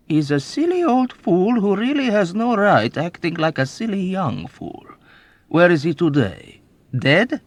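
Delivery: put-on high, loud, gleeful old man's boasting; old rogue bragging to a young officer; sly old man. A narrator's reading. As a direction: relish every wicked memory. Old man scorning the major.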